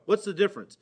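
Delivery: normal talking voice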